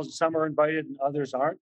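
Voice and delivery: ordinary talk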